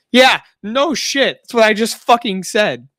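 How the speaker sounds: high, slow sarcastic "yeah, no shit"; sarcastic retort. Retold or imitated. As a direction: roll your eyes and drag out the obvious. Retorting to dumb remark.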